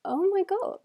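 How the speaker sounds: high-pitched retold "oh my God" moment; imitating own sudden alarm; sudden realisation. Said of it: "I suddenly went".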